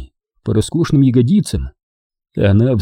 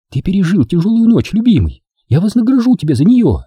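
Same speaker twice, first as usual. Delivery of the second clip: put-on higher young woman's voice, teasing; Дженни; 9 semitones higher than usual. A narrator's reading. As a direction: play the girlfriend, sweet and teasing. Jenny voiced by narrator. (нежная игривая Дженни)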